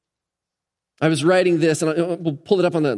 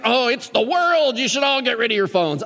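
hammed-up higher-pitched lament; mock alarmist; 7 semitones higher than usual. Preacher mimicking a critic of phones, mock alarmist. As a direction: imitate someone overreacting about the world. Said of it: "I'm not being like".

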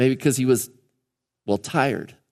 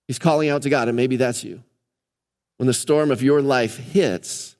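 normal talking voice

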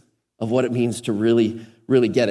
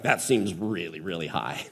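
dropped low and soft, almost to himself; a hushed disbelieving aside; murmured skeptical aside; quiet aside; 9 semitones lower, 9 dB quieter than usual. Retold or imitated. Private skeptical aside to audience.

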